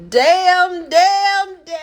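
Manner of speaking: anguished damn. Real, not acted. Lamenting the diet rules, loud high wail. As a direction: wail it like Florida Evans.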